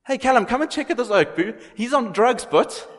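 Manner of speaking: loud, very high-pitched incredulous jeering; hammed-up mockery in a high, loud voice; mocking scoffer. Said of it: "were mocking at him, they were scoffing".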